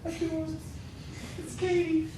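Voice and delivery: playful pun. Pretend. Joking about K T.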